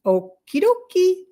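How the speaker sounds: high, drawn-out okie dokie; stretched, high-pitched compliance; chirpy agreement. Retold or imitated. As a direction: go along in a high, slow sing-out. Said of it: "And I was like".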